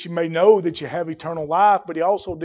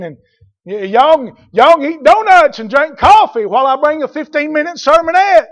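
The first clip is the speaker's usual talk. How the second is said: higher, louder pleading preacher parody; mocking a modern preacher; 7 semitones higher, 7 dB louder than usual. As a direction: beg them back like a spineless preacher. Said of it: "what a modern preacher do".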